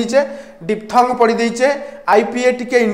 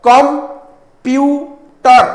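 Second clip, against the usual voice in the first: Computer broken into three long, loud beats with pauses between; split into syllables; 3 semitones higher, 4 dB louder, much slower than usual. Shown as a demonstration. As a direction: stretch computer very slowly as com... pu... ter. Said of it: "ତାକୁ ତମେ sound ପାର୍ଟରେ divide କର".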